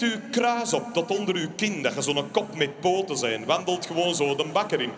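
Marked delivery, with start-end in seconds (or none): none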